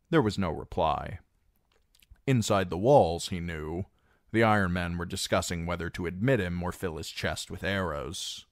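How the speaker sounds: ordinary delivery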